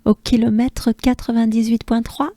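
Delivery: quick French number read. Shown as a demonstration. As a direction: rattle off the kilometre in French. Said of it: "I believe it's ninety-eight point three".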